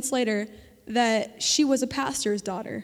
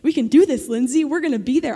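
much louder, urgent rallying cry; loud encouragement; 8 dB louder than usual. Acted. God assuring Lindsay she can do this, in a loud rallying call. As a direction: shout it out to lift her up. God's promise voiced to Lindsay.

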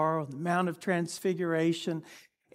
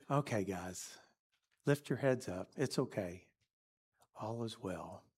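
soft, low and gentle reassurance; tender; 5 semitones lower, 7 dB quieter than usual. Acted. Jesus gently reassuring fallen disciples, soft and tender. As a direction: soothe them like waking a child. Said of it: "very tenderly says".

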